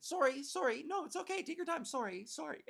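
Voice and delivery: apologetic Canadian. Retold or imitated. Canadian shopper reassuring the lady holding up the line, apologetic. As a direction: play an over-apologetic Canadian, voice lifted. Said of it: "me being the Canadian that I am".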